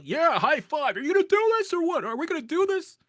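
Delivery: a coach slapping a high five and firing you up; hyping a friend before a big push, loud and fast; pumped-up hype. Pretend.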